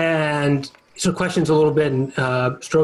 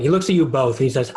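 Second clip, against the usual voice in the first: usual speaking voice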